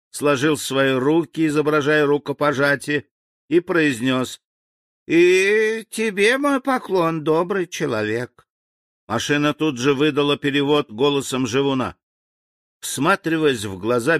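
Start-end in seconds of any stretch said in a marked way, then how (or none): 5.1-8.5 high, slow figure voice; bow with the voice, stretched high at first; courteous native's greeting. A narrator's reading. Native returns the general's greeting, high and courteous.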